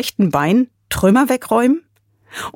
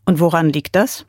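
ordinary delivery, nothing marked

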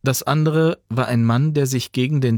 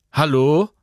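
louder, higher call into the wind; 4 semitones higher, 4 dB louder than usual. Acted. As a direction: shout to someone unseen. Calling into the storm.

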